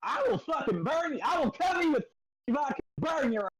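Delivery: very high, shouted threat; furious shout. Real, not acted. Player threatening the teasing friends, shouting. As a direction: yell the threat.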